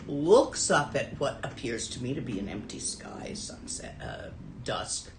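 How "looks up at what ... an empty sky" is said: normal talking voice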